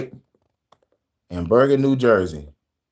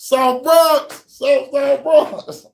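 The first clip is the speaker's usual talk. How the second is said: high hype call, stretched out slow; hype shout-out; 11 semitones higher, much slower than usual. Acted. Hyping South Bronx to the listeners, high hype call. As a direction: shout out the borough like a hype man.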